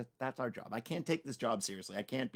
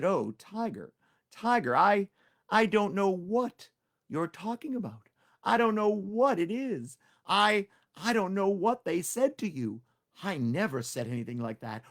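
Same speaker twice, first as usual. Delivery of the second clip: fake innocent plea; 7 semitones higher, 6 dB louder than usual. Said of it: "he put on a very sad face".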